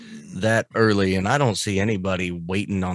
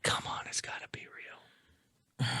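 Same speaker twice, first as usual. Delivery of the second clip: whisper; 17 dB quieter, faster than usual. Real, not acted. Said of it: "whispered hope".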